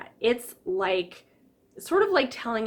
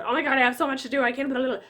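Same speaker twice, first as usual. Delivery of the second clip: higher, louder mock panic; stress mode; 5 semitones higher, 4 dB louder, much faster than usual. Imitating herself in stress mode, higher and louder. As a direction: rattle it off like she's overwhelmed. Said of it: "stress mode".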